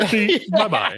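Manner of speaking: high laughing sign-off; mock cheery goodbye through a laugh; laughing bye-bye. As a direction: sing it off with a laugh. Mocking a declined call.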